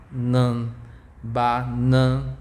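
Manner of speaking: ba open and bright, nan through the nose; open ba, nasal nan. Shown as a demonstration. The teacher contrasts the open and nasal A. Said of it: "they have a nasal sound".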